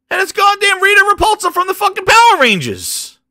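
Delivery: high, loud exasperated rant; outraged shout. Real, not acted. Ranting about Rita Repulsa turning up, outraged shout. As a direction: shout it in sheer disbelief.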